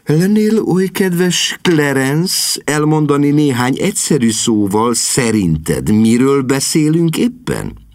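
strained patience. Acted. Sister loses patience.

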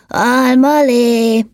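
high, very drawn-out call; blaring shout. A narrator's reading. Elf shouting drink ideas from the cart, long high holler. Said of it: "és egyre harsogott".

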